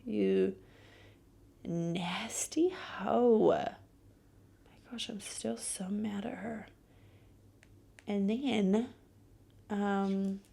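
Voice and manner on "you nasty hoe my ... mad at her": angry whisper. Real, not acted. Whispered insult and fuming.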